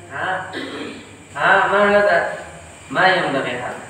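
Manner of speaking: usual speaking voice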